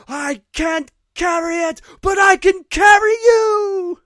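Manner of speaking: slow, straining heroic shout; Sam. Acted. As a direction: bellow it high, building to an epic cry. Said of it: "Sam picks him up".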